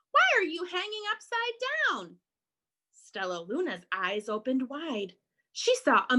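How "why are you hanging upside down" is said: high, quick, puzzled challenge; loud questioning. Acted. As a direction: demand it high and fast, as a bat. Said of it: "a loud voice said".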